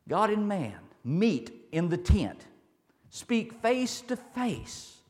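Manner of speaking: loud, deliberate sermon delivery; emphatic preaching. Real, not acted. Preacher expounding the tent of meeting to the congregation, emphatic preaching. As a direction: preach it slowly, punching the key words.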